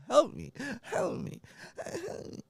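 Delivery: high wailing mock sobs, way up; fake crying. Filming a crying reel, he wails in hammed-up sobs. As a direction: wail it like a hammed-up crying scene. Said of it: "I'm crying".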